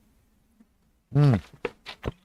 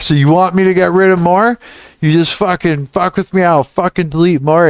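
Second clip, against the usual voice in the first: higher mock taunting; hammed-up defiance, pitched up; mock defiant threat; 6 semitones higher than usual. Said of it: "I go back into the feedback".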